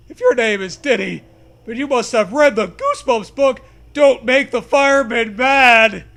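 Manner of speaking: loud hammed-up demand; mock challenge. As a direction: push the challenge hard. Ghost horse demanding.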